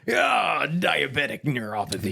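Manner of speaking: loud, high pirate punchline; pirate shout. Pretend. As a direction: bellow it like a pirate. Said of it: "And be like".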